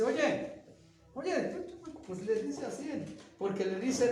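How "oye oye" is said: sharp high call of an upset mother; mock angry mother calling her son. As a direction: scold him like a worried mom. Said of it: "llegó su mamá bien enojada y le dice".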